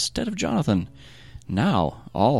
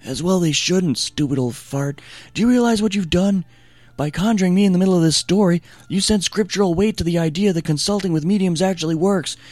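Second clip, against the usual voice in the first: put-on a much higher, louder, cranky old ghost; angry ghost voice; 10 semitones higher, 4 dB louder than usual. A narrator's reading. Samuel's ghost rebukes Saul in a high cranky shout. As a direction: shout down at Saul like an irate spirit.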